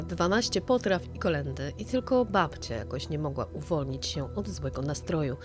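ordinary delivery, nothing marked

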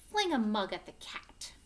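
lively, loud picture-book reading with big swings; teacher reading an angry-dinosaur story to the class, animated; reading to children. Acted. Teacher reads picture book aloud.

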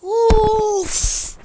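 high, loud sigh of frustration; loud fed-up huff at the game; exasperated loud groan. Real, not acted. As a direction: let out a big fed-up groan. Fails the parkour again.

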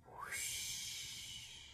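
breathy long whoosh; soft whoosh sound. A narrator's reading. Boggin agrees with a soft wind whoosh. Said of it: "Boggin said".

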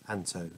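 "Anto" with a hard t; hard t pronunciation. Shown as a demonstration. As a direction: test "Anto" as AN-toe, no th. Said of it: "is that anth or ant?".

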